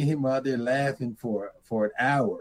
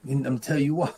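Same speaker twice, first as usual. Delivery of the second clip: ordinary delivery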